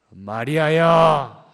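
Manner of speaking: long, slow, loud call of 마리아야; called name. Acted. Jesus calling Mary's name, loud and drawn out. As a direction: stretch the name out like a summons.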